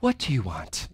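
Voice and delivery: soft and tender question, not a challenge; peace-loving. Shown as a demonstration. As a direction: ask it kindly, with no edge at all. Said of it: "It was peace-loving".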